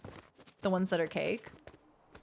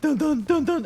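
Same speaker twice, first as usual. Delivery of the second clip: mock suspense sting, higher and louder; dramatic music sting; 8 semitones higher, 8 dB louder than usual. Imitating the dramatic music from a cake show, mock suspense. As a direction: hum it like a TV suspense cue. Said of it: "that dramatic music".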